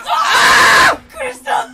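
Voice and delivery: 本気で驚いて大声で叫ぶ; 突然のことに悲鳴を上げる; 驚きの叫び. Real, not acted. (驚いて大声で叫ぶ)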